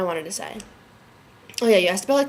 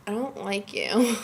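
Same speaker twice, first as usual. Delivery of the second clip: grumbling dislike; 4 dB quieter, slower than usual. Retold or imitated. Grumbling at late room check.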